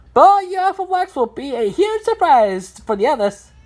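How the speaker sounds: high-pitched cartoon voice; continue in a high character voice; number block character.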